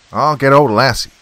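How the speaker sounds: loud shout while running off; high, loud parting yell. A narrator's reading. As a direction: call it back over your shoulder. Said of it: "he shouted".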